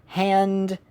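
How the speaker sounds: "Hand-ing-ton" in three separate parts with long pauses; split into syllables. Retold or imitated. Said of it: "people think of it as just".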